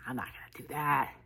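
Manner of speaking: teasing whispered aside. Retold or imitated. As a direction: whisper it like a secret to the crowd. [name] teasing, quoted aside.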